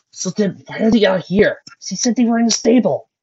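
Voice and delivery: Carter's voice. Acted.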